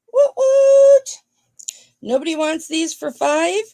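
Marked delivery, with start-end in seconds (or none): 0.1-1.1 high, loud woot cheer. Real, not acted. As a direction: cheer it out like a celebration. Celebrating during live auction.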